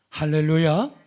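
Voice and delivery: hallelujah called out loud to the church; rally the congregation with a loud hallelujah. Real, not acted. Preacher calls out to congregation.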